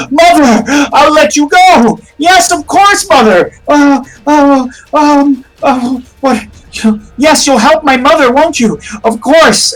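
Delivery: high, frantic cries to mother; deranged son in a high, gasping voice; unhinged Boothby. Acted. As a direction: plead with mother as if losing your mind. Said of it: "Boothby seems to kind of fucking be losing it".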